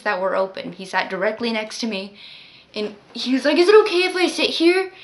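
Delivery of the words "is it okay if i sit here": higher, louder mock of a drunk old man. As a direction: imitate the pushy drunk asking to sit. Drunk man's quote.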